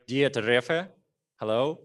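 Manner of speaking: ordinary talk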